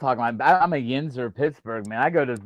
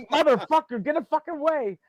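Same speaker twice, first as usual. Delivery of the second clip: imitated irate American lady, high-pitched; half-hearted yell, pitched way up; mock screaming; 11 semitones higher than usual. Imagining an American lady in that yard, mock screaming. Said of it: "They'd be screaming".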